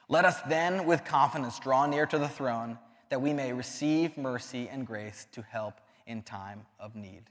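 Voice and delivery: slow, solemn reading of a verse; scripture reading. Acted. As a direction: read the verse reverently, slowing down. Reads scripture aloud.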